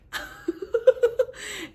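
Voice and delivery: high-pitched giggle; amused high laughter; real laugh. Real, not acted. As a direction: laugh at your own reaction. Laughs at own stunned reply.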